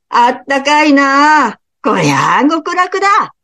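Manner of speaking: an ogre sighing happily over warm feet; delighted ogre. Acted. (高い声で大喜びする鬼)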